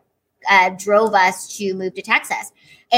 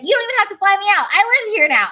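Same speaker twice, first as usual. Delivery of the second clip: higher, fast, eager burst; eager reply; 8 semitones higher, faster than usual. Retold or imitated. She answers the host's offer, excited and fast. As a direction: gush it out in a rush. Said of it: "And I was like".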